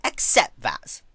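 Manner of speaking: slow, high and loud icy verdict; cold Twilight. Acted. Twilight informing the crying princess, icy and loud. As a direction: deny her flatly, from above.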